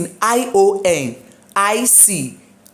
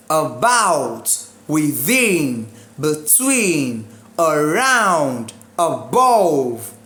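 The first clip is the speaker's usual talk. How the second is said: About, within, between, around, above, each stressed on its second syllable; 3 semitones lower, much slower than usual. Shown as a demonstration. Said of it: "exemptions preposition".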